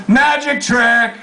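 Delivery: high, drawn-out yell. A narrator's reading. Woman crowing to the whole bus, drawn-out yell. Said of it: "she yells".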